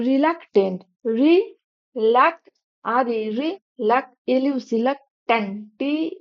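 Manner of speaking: slow spelling demo, pausing after each chunk; teacher breaking reluctant into slow, separate syllables. Shown as a demonstration. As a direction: say each piece of reluctant apart, then its letters. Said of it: "syllable ভেঙে ভেঙে করি".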